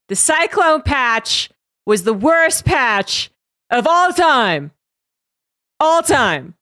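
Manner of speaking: slow, loud, booming Trump voice; Trump impression. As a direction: declare it slowly like Trump. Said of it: "That's a Trump voice".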